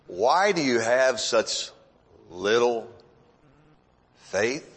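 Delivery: low, slow question with long pauses; God questioning. Retold or imitated. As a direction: ask gravely, word by word, dropping low. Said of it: "if God says to us".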